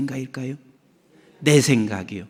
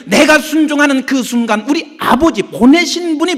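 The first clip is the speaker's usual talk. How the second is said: loud, higher preacher's exhortation; fervent preaching; 12 semitones higher, 11 dB louder than usual. Acted. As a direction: preach it to the congregation. Preaching at full force.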